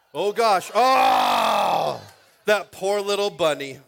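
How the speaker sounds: loud, high cry of alarm over the toy; loud laughing outcry; excited shout. Real, not acted. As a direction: yelp out in mock horror at the drop. Bunny toy dropped on stage.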